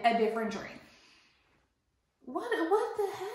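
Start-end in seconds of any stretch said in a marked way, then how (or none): 2.2-3.3 flabbergasted. Real, not acted. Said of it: "I was just flabbergasted".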